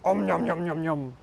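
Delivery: playful munching noises; nom nom chomping. Pretend. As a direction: chomp like a hungry caterpillar. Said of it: "make a munching sound".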